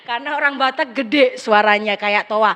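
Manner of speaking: ordinary delivery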